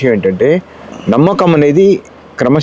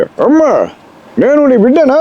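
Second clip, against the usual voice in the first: much higher, grand anguished plea to mother; NTR as Karna; 11 semitones higher, slower than usual. Shown as a demonstration. Karna demands of his mother, in booming NTR voice. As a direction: cry out to your mother like a wronged hero. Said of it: "Ramarao గారు కర్ణుడిగా చెప్పే".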